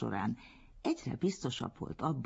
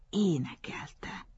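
awed; 6 semitones higher, slower than usual. A narrator's reading. Gushing praise of his singing delivery.

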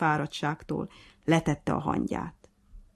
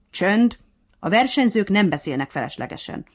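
stern; 4 semitones higher, 7 dB louder than usual. A narrator's reading. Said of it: "mondta szigorúan a nyúl".

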